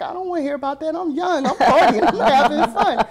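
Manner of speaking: put-on high, laughing, carefree young party girl; mock carefree, rising into laughter. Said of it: "And I was like".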